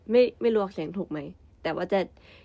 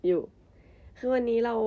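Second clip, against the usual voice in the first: ordinary delivery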